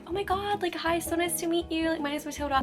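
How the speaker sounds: high-pitched put-on American accent. Greeting a new girl at work, gushing American accent. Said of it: "Why I did it in that accent".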